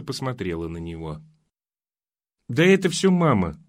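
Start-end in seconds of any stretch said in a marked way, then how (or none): 2.5-3.6 annoyed. Acted. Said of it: "сказала она с досадой".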